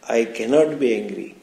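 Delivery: ordinary talk, nothing marked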